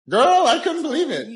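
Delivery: put-on high, gushing teenage girl gossip; teen daughter voice. Father imitating his teenage daughters gossiping, high girlish voice. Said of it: "you were just like".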